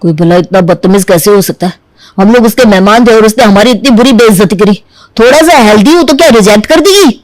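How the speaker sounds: ordinary delivery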